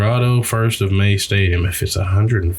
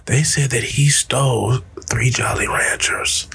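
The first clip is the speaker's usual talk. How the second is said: hushed whisper; 3 semitones higher than usual. Retold or imitated. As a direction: whisper it like a secret. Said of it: "I'm like this, like".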